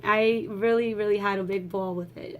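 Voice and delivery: usual speaking voice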